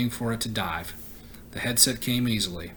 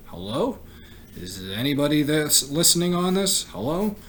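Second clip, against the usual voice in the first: anxious calling out; 5 semitones higher than usual. Acted. Calling on radio for help.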